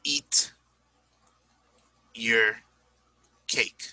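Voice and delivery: each word set apart with long pauses; I didn't eat your cake, spaced out like a drill; slow example sentence for students; slow word-by-word demo. Shown as a demonstration. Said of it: "teacher demo sentence".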